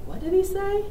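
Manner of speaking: high, baffled question; puzzled parent. Retold or imitated. Mother imitating parents who can't understand their son, high and baffled. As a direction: ask it like you caught none of it. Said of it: "my husband and I will be like".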